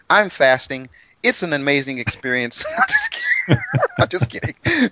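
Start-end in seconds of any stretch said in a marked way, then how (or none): 2.7-4.9 real laughter through the words; laughing. Real, not acted. Host admits joking about fasting, laughing. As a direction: crack up at your own joke.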